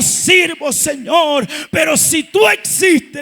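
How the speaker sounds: loud, impassioned plea to God; full-force preached prayer. Retold or imitated. Preacher retelling how he offered his life to God, full-force preaching.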